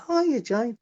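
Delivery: high, bright-eyed hello; delighted greeting. Retold or imitated. Everyone greets Jane, delighted.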